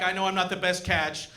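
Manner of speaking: usual speaking voice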